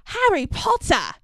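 excited, lightly voiced house-elf; squeaky elf greeting, lightly; Dobby voice. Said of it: "Dobby's first lines".

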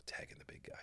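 a quick whisper to the tag partner; whispered aside. Retold or imitated. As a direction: breathe it, no voice at all.

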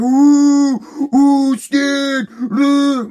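loud, high mocking oohs; teasing ooh. Warehouse coworkers teasing the dad about the call, loud mocking oohs. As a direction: razz him like the whole warehouse is in on it.

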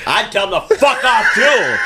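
laughing, high-pitched pretend indignation; mock outrage. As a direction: pile on with hammed-up high outrage through laughter. Co-host joins the mock outrage.

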